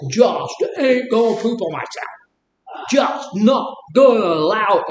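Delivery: hammed-up strained insistence rising to a shout; mock determination. Mocks stopping diarrhea by willpower with a hammed-up straining shout.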